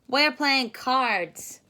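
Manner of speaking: slow, loud smug monkey reply; monkeys answering. Acted. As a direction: drawl it out like a showing-off monkey. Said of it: "they said".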